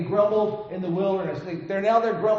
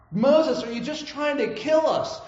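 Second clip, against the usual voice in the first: higher, accusing whine at Moses; grumbling complaint; 6 semitones higher than usual. Retold or imitated. Israelites grumbling at Moses, a whining accusation. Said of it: "they've grumbled and complained".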